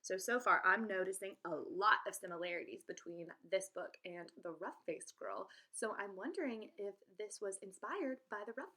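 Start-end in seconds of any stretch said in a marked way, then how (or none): none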